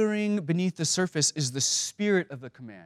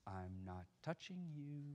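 sly taunt; 20 dB quieter than usual. Retold or imitated. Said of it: "she stops and says".

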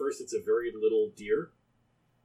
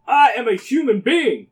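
high, loud put-on voice; unfamiliar voice; 10 semitones higher, 8 dB louder than usual. Jackalope claims to be human, in an unfamiliar high, loud voice. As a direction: declare it in a strange raised voice. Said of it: "in a voice you don't recognize".

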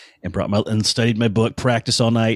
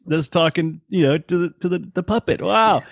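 higher, awed and delighted; starstruck awe; 7 semitones higher than usual. Retold or imitated. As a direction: gush like meeting a childhood hero. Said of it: "that first moment when you go".

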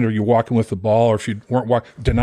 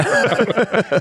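high burst of laughter; real laugh; 11 semitones higher than usual. Real, not acted. Recalling getting run over, high laughing burst.

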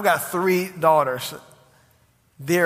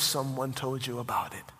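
whisper; 4 semitones lower, 7 dB quieter than usual. Acted. God's words read softly.

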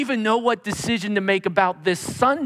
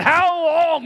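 high, loud plea; Habakkuk crying out; 10 semitones higher, 6 dB louder than usual. Retold or imitated. Habakkuk crying out to the Lord, loud high plea. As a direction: cry up to heaven. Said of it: "Habakkuk cried out".

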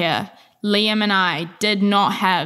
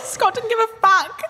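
high, slow shriek through laughter; laughing shout; slower than usual. Real, not acted. Recalling the useless agency guy, laughing shout. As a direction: crack up while crying it out. Both cracking up remembering Scott.

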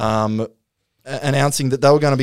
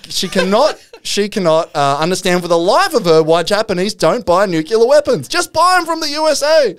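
raised mock exasperation, climbing to a high exclamation; Margaret Thatcher; 7 semitones higher, 4 dB louder than usual. Channeled Thatcher advising Japan on weapons, mock exasperation. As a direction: build to a loud, hammed-up finish. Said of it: "Margaret Thatcher starts advising".

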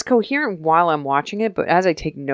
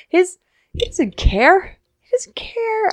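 high emphatic whine; slower than usual. Real, not acted. Host insisting the villain doesn't care, high whine. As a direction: pitch shooting up, slow and plaintive. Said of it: "emphatic repeat".

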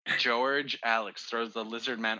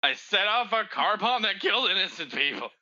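melodramatic confession; 8 semitones higher, 3 dB louder than usual. Acted. Dramatic confession to end scene.

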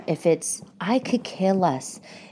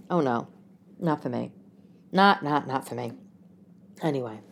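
ordinary delivery

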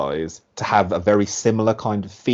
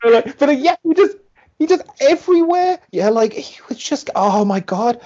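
very high, stumbling over the words; overwhelmed excitement. Real, not acted. Co-host reliving a brutal match, overwhelmed and excited. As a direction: squeal through it, lost for words.

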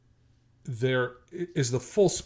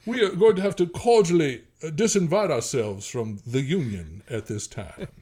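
genteel Virginia accent; 5 semitones higher, 5 dB louder than usual. Shown as a demonstration. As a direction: ham up a courtly Virginia accent, slowing down. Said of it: "You know, that kind of thing".

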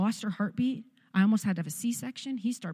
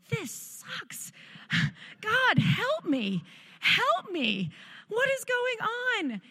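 much higher, slow, strained cry to God; desperate pained prayer; 12 semitones higher, much slower than usual. Retold or imitated. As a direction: plead like you're mid-labor and breaking. Desperate prayer mid-labor in pain.

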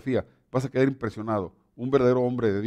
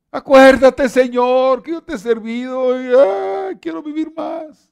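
much higher, loud wailing plea that fades at the end; the dying king wailing to God for more life, high and loud; desperate pleading cry; 11 semitones higher, 8 dB louder, slower than usual. Retold or imitated. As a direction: beg God for life, near tears. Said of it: "llorando y gritando, clamando".

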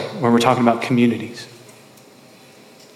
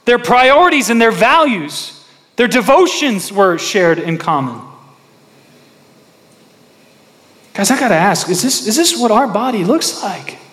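high, loud, fervent sermon delivery; preaching at full force; 8 semitones higher, 5 dB louder than usual. Acted. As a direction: preach it out to the congregation.

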